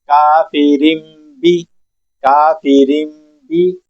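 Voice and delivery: کافرین بھی slowly, nun turned to m, kafirim bi; iqlab demo. Shown as a demonstration. Teacher showing iqlab on the example. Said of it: "یہاں پر ہم چھوٹی سی میم ادا کریں گے".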